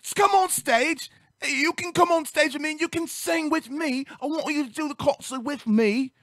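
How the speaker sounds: high, half-shouted British-accent imitation; mock British singer. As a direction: playfully ham up the star inviting him up, British accent. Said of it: "And he goes".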